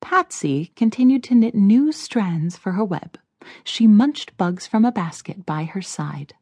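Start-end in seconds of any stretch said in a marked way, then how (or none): none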